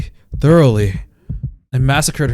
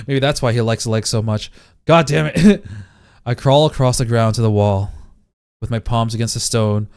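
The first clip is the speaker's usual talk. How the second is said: ordinary talk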